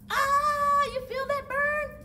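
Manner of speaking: playful strain. Acted. Coach jokes about the burn.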